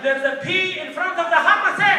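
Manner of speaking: high, loud mock outrage; hammed-up indignation, building to a yell; indignant uncle. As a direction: shout the correction like an exasperated uncle. Uncle angrily corrects the pronunciation.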